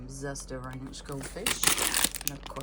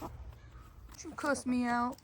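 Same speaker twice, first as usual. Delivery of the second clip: mock scolding; 8 semitones higher, 4 dB quieter, slower than usual. Mock scolding a noisy hen.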